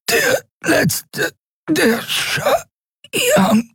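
very high, strangled fragments; choking rattle. A narrator's reading. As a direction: push the words out through a crushed throat. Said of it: "Stimme wurde zu einem unverständlichen Röcheln". (röchelnd, gewürgt)

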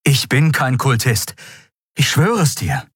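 high-pitched, insistent protest; vehement denial. A narrator's reading. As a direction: swear it with urgency. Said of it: "mit derselben Heftigkeit wie zuvor". (heftig beteuernd)

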